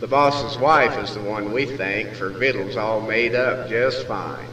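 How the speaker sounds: ordinary delivery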